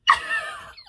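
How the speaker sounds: delighted high-pitched squeal of laughter. Real, not acted. Squealing at fictional man's line.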